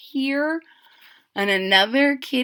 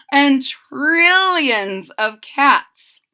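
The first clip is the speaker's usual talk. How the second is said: slow, louder trillions; drawn-out climax; 7 dB louder, much slower than usual. A narrator's reading. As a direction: stretch the final count out.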